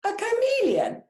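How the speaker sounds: loud squawk. Acted. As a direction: squawk it in disbelief. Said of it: "squawked the parrot loudly".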